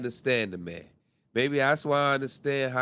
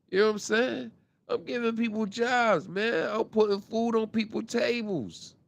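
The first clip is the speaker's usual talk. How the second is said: imitating a bragging rapper; 6 semitones higher than usual.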